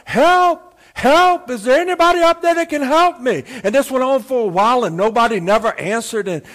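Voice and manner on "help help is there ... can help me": high, loud cry for help; hollering for help. Acted. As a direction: holler up from a cliff for anyone to hear. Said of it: "old Jack began to holler out".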